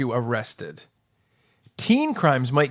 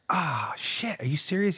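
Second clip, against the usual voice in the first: breathy whisper of dismay; 3 semitones higher, 7 dB quieter than usual. Retold or imitated. Whispering to the cop about the news. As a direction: hush it like you've just been caught. Retold reaction, whispered quietly.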